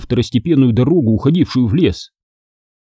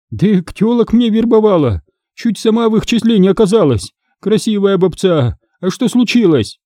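high-pitched gossipy informant; informing captive woman; 9 semitones higher than usual. Acted. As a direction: answer the interrogator in a high voice.

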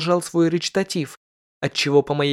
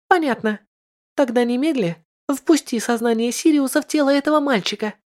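high, eager noblewoman; impatient high lady asking; lady in red; 8 semitones higher than usual. Acted. (нетерпеливая знатная дама)

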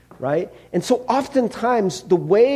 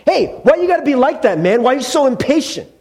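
mock indignant complaint; 6 semitones higher, 4 dB louder, faster than usual. Mocking driver who cut him off.

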